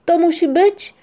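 high, loud cry of realisation; acted excited outburst; exclaiming. A narrator's reading. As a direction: call out a sudden discovery. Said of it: "jednocześnie zawołali".

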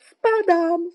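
high, loud cry of alarm; panicked high-pitched scream of a word; terrified shout. A narrator's reading. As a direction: yell out in panic. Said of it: "krzyknął przerażony".

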